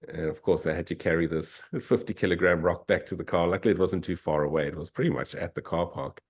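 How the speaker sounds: ordinary delivery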